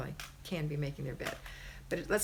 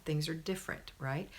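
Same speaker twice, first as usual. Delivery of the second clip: normal talking voice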